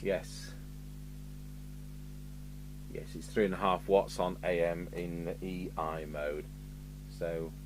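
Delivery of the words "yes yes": normal talking voice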